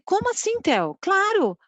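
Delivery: sharp high astonished question; surprised. Retold or imitated. Mother stunned by her son's question, surprised. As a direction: jump up in pitch, caught off guard. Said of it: "eu fiquei tão surpresa".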